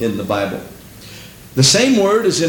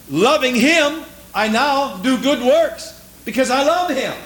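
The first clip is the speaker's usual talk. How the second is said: high, fervent preaching cry; preaching at full force; 8 semitones higher than usual. Acted. Preacher testifies to his congregation, in high fervent cry. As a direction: lift it up and preach it hard.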